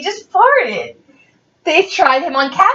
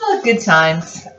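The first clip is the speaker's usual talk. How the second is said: usual speaking voice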